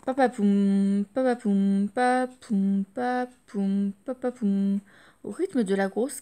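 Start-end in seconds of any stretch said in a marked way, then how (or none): 0.0-4.8 drum imitation.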